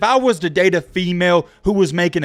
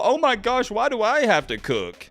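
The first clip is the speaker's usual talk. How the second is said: mocking girlfriend; 5 semitones higher, 4 dB quieter than usual. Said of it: "And they go".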